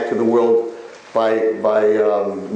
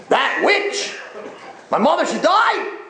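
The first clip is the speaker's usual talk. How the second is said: high, drawn-out gloating outcry; hammed-up jubilant phone voice; gleeful celebration; slower than usual. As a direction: crow over the news like a windfall. Relative rejoices at mother's death.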